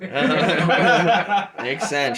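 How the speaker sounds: laughs through the words; amused laughing repeat. Real, not acted. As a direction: say it while cracking up. Player repeats the line laughing.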